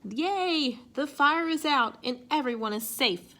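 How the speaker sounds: ordinary delivery, nothing marked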